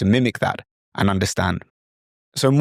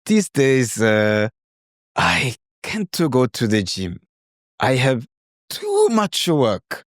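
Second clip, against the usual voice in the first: Korean learner's English; slower than usual. Retold or imitated. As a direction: imitate a Korean student answering slowly. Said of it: "And they might say".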